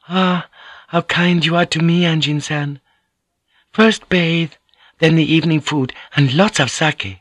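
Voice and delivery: a courteous lady explaining sweetly; Mariko, gently teasing. Acted. Narrator voices Mariko.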